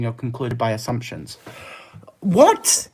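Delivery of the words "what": shocked. Real, not acted. Reacting to a chat message.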